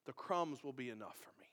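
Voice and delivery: soft whispered plea; humble whisper, as if asking quietly. Acted. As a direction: drop into a hushed whisper. Voicing the woman's plea.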